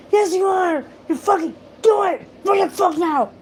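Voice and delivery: high, loud reenacted shout; reenacting screaming at Carter to put on the aloe; retold shouting. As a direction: shout it at him at full force. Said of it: "and I yelled at him".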